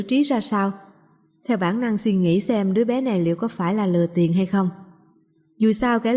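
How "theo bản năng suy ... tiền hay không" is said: plain reading voice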